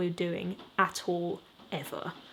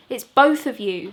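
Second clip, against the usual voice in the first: blaming retort; 9 dB louder than usual. Acted. Rowan blames, louder.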